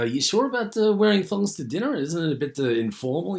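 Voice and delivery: high, hammed-up American accent; mock a doubtful American guest in a put-on American accent; playful bad American accent. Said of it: "That's my really bad, American accent".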